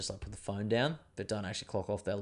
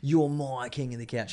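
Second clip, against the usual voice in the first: mock pep talk; 5 semitones higher than usual. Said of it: "she's like".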